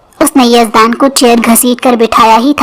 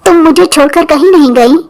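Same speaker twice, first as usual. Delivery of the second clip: a higher, bright-eyed happy question; joyful chirp finding his bride again; chirpy, delighted; 4 semitones higher than usual. A narrator's reading. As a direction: chirp it like a relieved child. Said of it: "چہکتے ہوئے کہا".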